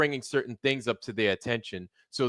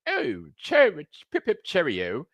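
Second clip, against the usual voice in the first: mock British; 7 semitones higher than usual. Live streamer mocking how British people sound, exaggerated British accent. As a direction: ham up a cartoon British "cheerio". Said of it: "He doesn't sound British, British, like".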